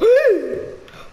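sharp high startled gasp; sudden gasp. Real, not acted. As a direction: gasp in fright. Spaventato da un nemico. (sussulto spaventato)

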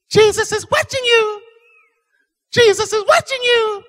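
very high, loud squawky bird voice; high-pitched piercing parrot. Acted. Parrot calls out to a robber in the dark, high and piercing. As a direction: pierce the dark in a shrill falsetto. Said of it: "a little bitty parrot bird".

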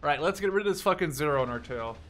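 ordinary talk, nothing marked

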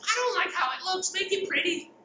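high mock complaint, imitating a user; whiny user. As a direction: whine like a fussy user who wants it pretty. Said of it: "they think of SharePoint is".

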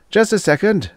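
high, calling out loud; shouting. A narrator's reading. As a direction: call out to the ship. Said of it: "Sherwood shouted".

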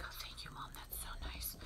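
very soft, breathy mock sigh of thanks; relieved, chilled-out cat. Calmed mother cat thanking her breeder, softly whispered relief. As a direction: whisper it as a soothed, grateful cat. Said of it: "able to chill".

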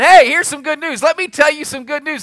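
high, loud, excited heralding; eager announcement. Acted. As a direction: burst out with glad tidings. God voiced announcing good news.

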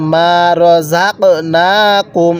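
long drawn-out chanted recitation, every vowel stretched; slow Quran recitation. Shown as a demonstration. Teacher models the verse for students, slow drawn chant. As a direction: intone it slowly, as the teacher's model reading. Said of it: "নুনের মধ্যে এক আলিফ টান".

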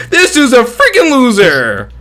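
loud, high taunting announcement; loud mocking. Real, not acted. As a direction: taunt him loudly to everyone. Loudly mocking teammate to lobby.

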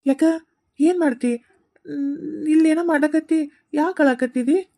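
high and loud, worried questions; a friend startled to find the boy crying; alarmed concern. Acted. Friend finds Ramu crying. (ಗಾಬರಿಯ ಜೋರು ಪ್ರಶ್ನೆ)

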